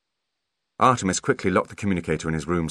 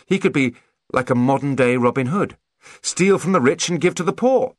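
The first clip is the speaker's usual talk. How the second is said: higher, louder, rising to the end; grand enthusiasm; 8 semitones higher, 4 dB louder than usual. A narrator's reading. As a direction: proclaim a grand new plan. Grand idea, then undercut.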